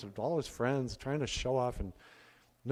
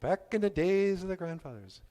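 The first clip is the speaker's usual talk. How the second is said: put-on a bit higher, told like an old tale; storyteller opening; 7 semitones higher than usual. Shown as a demonstration. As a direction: lean into the storytelling tone. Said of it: "all my stories start like that".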